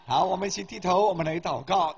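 ordinary talk

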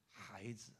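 low, tender divine call; gentle voice. Acted. An unseen voice calls the sick student, gently. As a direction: call softly and low to a child. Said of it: "很温柔的声音说".

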